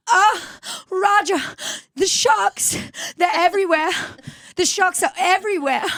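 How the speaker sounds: very high, gasping mock terror; scared Mid-Atlantic accent. Castaway surrounded by sharks, hammed-up Mid-Atlantic fright. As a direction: panic like an old-film damsel. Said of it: "they got so scared that they just started".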